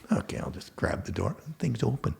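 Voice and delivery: whispered, as if sneaking round the lot; hushed, unvoiced aside; sneaky whisper. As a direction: breathe it like someone trying a car door at midnight. Sneaking into truck at midnight.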